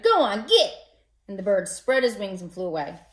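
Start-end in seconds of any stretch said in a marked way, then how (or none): 0.0-0.7 higher, louder shout. Acted. Boy shouting to scare a crow away, loud. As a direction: chase the crow off. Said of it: "the boy flapped his arms and shouted".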